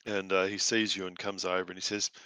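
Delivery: ordinary talk, nothing marked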